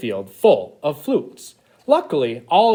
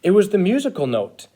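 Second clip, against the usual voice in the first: plain reading voice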